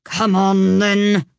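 loud, sustained royal roar; roared order. A narrator's reading. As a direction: roar the summons, holding the words. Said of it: "roared the Queen".

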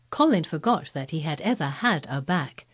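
ordinary delivery

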